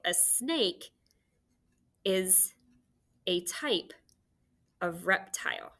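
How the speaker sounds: word by word, softly, with long pauses; slow dictation. Shown as a demonstration. Teacher giving pupils an example sentence, slow dictation. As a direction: dictate it quietly in small separated chunks.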